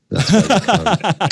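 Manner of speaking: sudden high loud bark of a corporal; mock yell. Corporal shouting at a recruit, mock yell.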